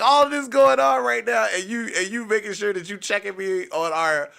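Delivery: loud, high exasperated disbelief; incredulous retort. Retold or imitated. Boyfriend amazed by her drunken priorities, loud and incredulous. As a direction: protest loudly, voice shooting up.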